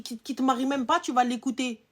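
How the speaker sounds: ordinary delivery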